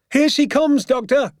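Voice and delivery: high, urgent announcing call. A narrator's reading. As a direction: call out loudly to the doctor, high-pitched. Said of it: "my father called out".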